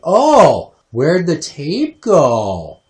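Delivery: high, loud drawn-out wail of a question; exaggerated "where did it go", slow and loud; big hammed-up cry of dismay; mock dismay. Mimics mother missing her tape.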